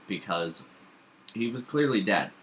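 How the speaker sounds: ordinary talk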